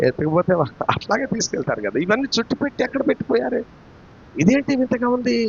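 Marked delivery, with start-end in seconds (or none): 2.0-5.4 high-pitched puzzled wondering; acted bewilderment. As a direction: wonder aloud at something strange. Acts out puzzlement at the tomb. (ఆశ్చర్యపోతూ అయోమయంగా)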